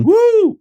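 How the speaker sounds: loud, very high whoop; mock crowd-rousing whoop; hype "woo". Imitating the hype introduction.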